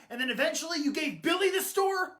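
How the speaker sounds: ordinary talk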